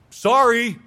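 更高更响、夸张敷衍的"Sorry"; exaggerated sorry. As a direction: toss out a high, loud, overdone sorry. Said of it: "that kind of a response".

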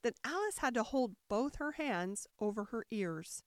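plain reading voice

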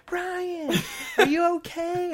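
high, anxious mother calling out; worried mom voice. Retold or imitated. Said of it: "my mom going".

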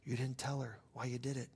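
softly spoken inner prompting; still small voice. Retold or imitated. God prompting the driver after the good deed, soft voice. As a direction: say it softly and simply. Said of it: "I hear that voice again".

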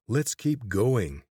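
slow and loud, calling ahead. Acted. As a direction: call it out slowly into the dark. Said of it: "Corvin raised his voice".

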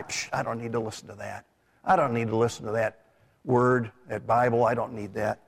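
quick, low, offhand brush-off; dismissive. Acted. Preacher voicing rebels who spurn God's word, dismissive.

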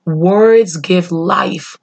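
ordinary delivery

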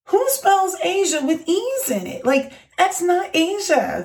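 high-pitched disbelief; indignant, pitched well up; incredulous. Retold or imitated. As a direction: retell it as an exasperated, incredulous question. Incredulous reaction to silly question.

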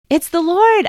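high, loud joyful shout. Acted. Peter shouts to the others on recognizing Jesus. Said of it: "I shouted".

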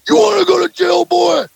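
put-on very high, loud, menacing cop in a kid's face; hammed-up angry cop yelling at a teenager; furious cop shout. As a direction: scream it in his face like a bullying small-town cop. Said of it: "He goes, he get in my face".

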